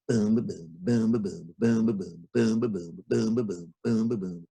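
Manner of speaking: low drum beats spoken; drum boom chant. Acted. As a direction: beat the belly like a drum. Banging drum on cloud.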